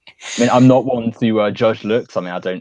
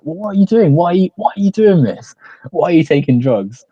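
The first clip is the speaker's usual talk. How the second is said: high, raised, fretful questioning; mock scolding; 8 semitones higher, 4 dB louder than usual. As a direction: act out a worried telling-off. Acting a mother scolding Denny.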